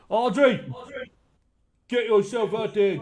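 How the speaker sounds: loud, high call across the tavern; yelling for the barmaid. Acted. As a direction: bellow her name over the crowd. Innkeeper yells for barmaid.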